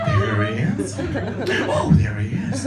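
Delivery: low, smug self-satisfied finds; smug Waldo circler. Retold or imitated. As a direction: gloat over each find.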